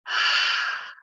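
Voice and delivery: long audible breath out; breathy sigh, releasing as the shoulders drop; demonstrated exhale. Shown as a demonstration. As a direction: let out a big sighing exhale. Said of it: "Now breathe out as you roll them down".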